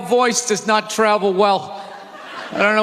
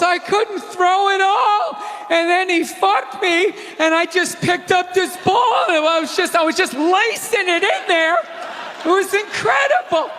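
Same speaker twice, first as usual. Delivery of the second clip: much higher, breathless bragging voice; mock wife gushing; 9 semitones higher than usual. Comic voicing Brady's wife bragging about throwing, high and giddy. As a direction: gush like a dazzled amateur.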